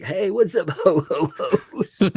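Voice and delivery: high laughing Santa greeting; jolly ho ho ho. Retold or imitated. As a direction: chuckle through a Santa ho ho ho. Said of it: "that's my ecosystem greeting".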